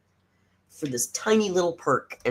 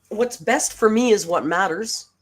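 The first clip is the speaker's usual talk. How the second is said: mimicking her; 6 semitones higher, 4 dB louder than usual. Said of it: "She says".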